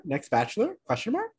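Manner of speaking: ordinary talk, nothing marked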